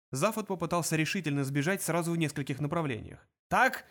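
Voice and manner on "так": high, loud exclamation; sudden cry. A narrator's reading. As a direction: burst out with it. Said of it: "вскричал он".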